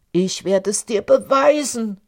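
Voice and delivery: loud, furious giant; angry shout. A narrator's reading. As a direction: roar it in anger. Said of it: "rief jener ärgerlich".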